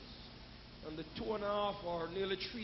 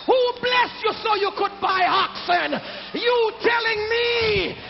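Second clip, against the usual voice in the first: louder, higher preaching with rhythmic shouted lines; full-force preaching; 8 semitones higher, 12 dB louder than usual. Acted. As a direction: hammer each line out to the congregation.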